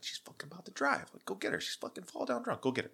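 urgent hushed sister voice; worried sister. Retold or imitated. Sister urging him to stop the drunk girlfriend, high hushed rush. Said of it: "She's like".

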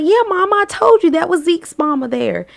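put-on high, lilting country girl; retold country daughter. Daughter answering her mama about Zeke, high country voice. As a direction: pitch it up, imitating a daughter. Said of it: "And she was like".

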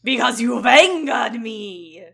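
slow, loud, furious god voice; angry booming shout. Acted. Ellimist angered, shouting.

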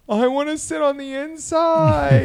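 put-on high, slow, pleading kid voice; imitating her daughter's high drawn-out demand; whiny little girl. Mom voicing daughter after ride.

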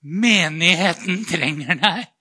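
slow, laughing, put-on religious tone; mock how holy it sounds; laughing mock pious voice. Mocking his inner voice with a laugh, pious. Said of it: "hør så fint".

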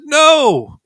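high, loud preacher's shout of "No."; emphatic no. Shown as a demonstration. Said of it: "I said".

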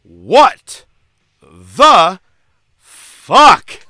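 drawn-out shouted words, one by one; shouted punchline. Acted. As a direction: yell each word, spaced apart. Punchline of ringmaster intro.

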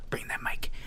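hushed whisper. Acted.

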